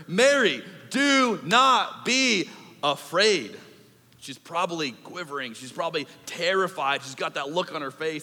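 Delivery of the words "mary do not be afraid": the angel's slow, louder command. Acted. Preacher voicing the angel calming Mary, slow and louder. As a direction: draw out do not be afraid like a herald. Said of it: "What does the angel say to her?".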